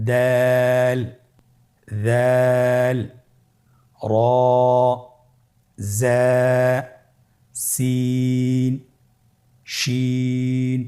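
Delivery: introducing the new Arabic letters; drawn-out letter names. Shown as a demonstration. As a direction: hold every letter name long, like a recitation. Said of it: "হরফগুলো হলো".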